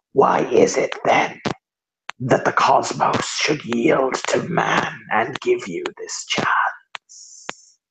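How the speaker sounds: breath-heavy rasp sinking into a whisper; rasping figure. Acted. Figure challenges the man to earn his chance, rasping. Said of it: "rasping voice that sounds like needles on".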